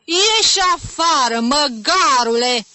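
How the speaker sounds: high, loud, drawn-out yell; furious shouting; enraged bellow. A narrator's reading. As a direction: roar at the pupil, beside yourself. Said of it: "zbieră el scos din fire".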